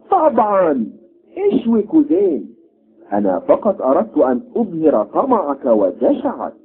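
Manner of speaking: haughty prince. Acted. Prince orders, then scolds.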